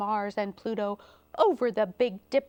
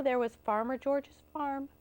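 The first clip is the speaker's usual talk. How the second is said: plain reading voice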